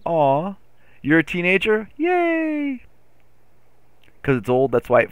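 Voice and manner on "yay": high, small squealed cheer; mock cheer. Streamer reacts to a teenager in chat with a high little cheer. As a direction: squeak out a drawn, unimpressed-volume yay.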